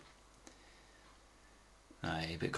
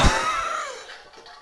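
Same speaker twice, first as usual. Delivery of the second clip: unvoiced high cackle; high shrieking laugh. Real, not acted. Laughing at dad's story, high wheezing laugh.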